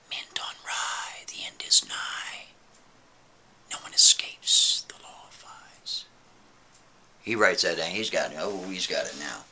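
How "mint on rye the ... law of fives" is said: slow ghostly whisper, barely voiced; eerie whisper. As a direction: hiss the words out like a spirit. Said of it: "they say to him like this".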